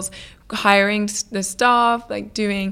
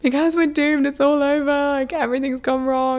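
higher, whiny doom wail; mock despair; 7 semitones higher than usual. Retelling to Matt through tears, a higher hammed-up wail. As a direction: play up the melodrama of despair. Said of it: "I was crying so much".

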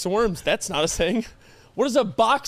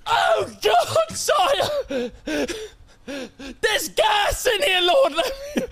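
trapped pet crying; 13 semitones higher, much slower than usual. Pretend. Pet trapped in owner's bed, high crying plea. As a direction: beg to be let out like a trapped pet. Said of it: "and I just go".

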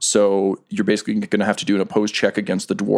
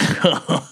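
slow villainous chuckle; monster cackle; 8 semitones higher than usual. Acted. Game master as the giant-eyed monster, cackling. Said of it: "the giant-eyed monster cackle".

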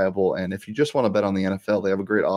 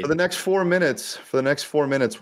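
high, huffy and indignant; mock offended; 6 semitones higher than usual. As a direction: play wounded pride, pitched up. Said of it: "Did I really hurt your feelings there?".

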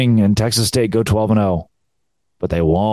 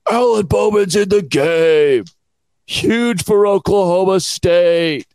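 sarcastic fan hype, pitched way up; mock hyped fans; 10 semitones higher, slower than usual. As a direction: gush it like an overexcited fan posting online. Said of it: "I saw all the tweets are like".

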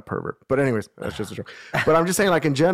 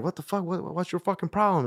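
mock-confront someone in a higher voice; indignant protest; 6 semitones higher, 3 dB quieter than usual. Acting his own indignant confrontation.

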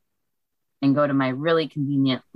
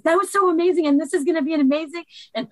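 high, bursting with excitement, racing ahead; giddy excitement; 3 dB louder, faster than usual. Retold or imitated. Walking out the library doors after her first recording, bursting with excited joy. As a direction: shriek out the thrill like you can't contain it. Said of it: "so amped up and so excited".

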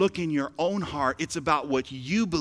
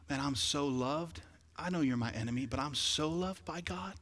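soft inner voice; 2 semitones lower, 7 dB quieter than usual. Pretend. Imagined believer's inner voice.

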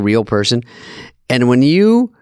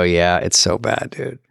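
lazy drawl; 6 semitones lower, 3 dB quieter, slower than usual. Retold or imitated. Staff voiced lazily.

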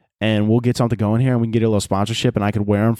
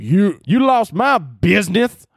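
high, loud, slow southern drawl; southern accent; 9 semitones higher, 4 dB louder, slower than usual. Acted. Mimicking an angry online commenter, southern accent. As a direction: holler it slowly in an exaggerated southern accent. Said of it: "like, a southern accent".

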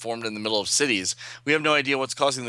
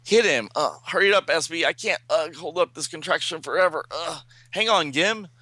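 higher, urgent voice with effort grunts; straining hero; 6 semitones higher than usual. Acted. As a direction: gasp out orders while straining to hold on.

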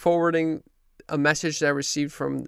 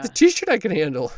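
amused, louder and higher; laughing; 4 semitones higher, 7 dB louder than usual. Real, not acted. Son answering mother's party question, laughing. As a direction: say it through a laugh.

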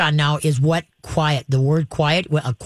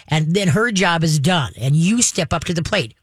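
ordinary talk, nothing marked

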